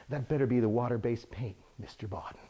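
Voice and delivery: normal talking voice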